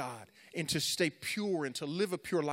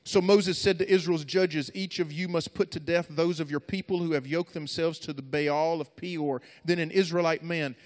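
louder, higher preacher's reading voice; forceful scripture reading; 4 semitones higher, 9 dB louder than usual. Acted. Preacher reads Moses's judgment to a youth crowd, forceful scripture reading. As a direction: proclaim the passage to the room.

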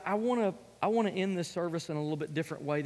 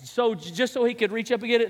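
normal talking voice

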